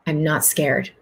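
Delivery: soft, slow, reassuring; calm him down gently; quiet soothing reassurance; gentle reassurance. Retold or imitated. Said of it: "She said".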